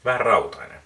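speaking with cigar against mouth; cigar at the lips. Real, not acted. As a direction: lips partly blocked by cigar. Seen 0: Cigar pressed at or near lips throughout; not clearly clenched in mouth.

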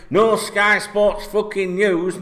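usual speaking voice

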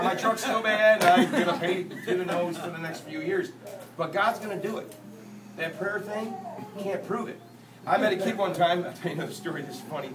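ordinary talk, nothing marked

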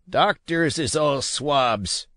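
slow, high sneer of a sea dog; old sailor's slow scoff; scornful old captain. A narrator's reading. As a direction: spit out contempt for doctors.

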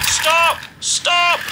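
high, loud wail; Henry wails as the trucks push him downhill. A narrator's reading. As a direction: cry out in alarm. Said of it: "wailed Henry".

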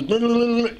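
high mock foreign babble; mock German gibberish. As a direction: imitate a clerk babbling at you. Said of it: "in German".

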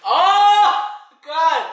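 high, loud pained cry; pained outcry. Real, not acted. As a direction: cry out from the burn. Reacting to spicy noodles.

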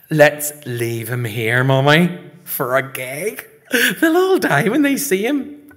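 put-on loud, rising child's enthusiasm; excited prank pitch. Son talking ma into a gag, excited. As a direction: build to a high, loud finish.

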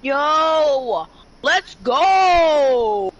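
loud, pumped cheer; hyped celebration. Real, not acted. Celebrating in game.

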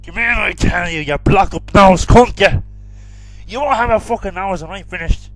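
angry shouting. Acted. Threatening the medium.

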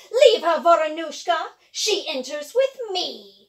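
loud commanding witch. Acted. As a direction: order the crow away.

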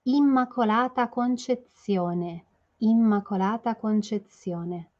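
Immacolata Concezione drawn out, every syllable clear; teacher modelling Immacolata Concezione; slow model pronunciation. Shown as a demonstration. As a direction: spell out Immacolata Concezione slowly. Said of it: "Vamos fazer primeiro a pronúncia?".

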